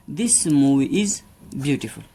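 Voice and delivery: very slow, word by word example sentence; slow dictation. Shown as a demonstration. Teacher dictating an example to students, very slow. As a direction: spell it out slowly for the class.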